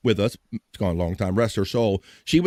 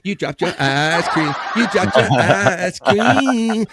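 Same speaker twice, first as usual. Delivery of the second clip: slow, laughing schoolyard gloat, rising louder; gloating taunt; 5 semitones higher, 4 dB louder, much slower than usual. Retold or imitated. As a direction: stretch it out and laugh at them. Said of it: "one of my favorite bits from Eddie Murphy".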